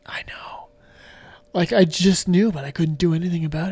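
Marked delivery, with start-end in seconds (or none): 0.1-0.7 breathless whisper. Retold or imitated. To a friend at the hospital, a weak whisper. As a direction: barely breathe the words. Replying weakly from hospital bed.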